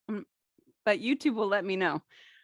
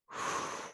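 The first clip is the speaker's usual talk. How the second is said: soft, forceful-sounding whoosh of breath; empty the lungs through the mouth; audible whoosh exhale; 9 dB quieter than usual. Shown as a demonstration. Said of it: "do that same whoosh".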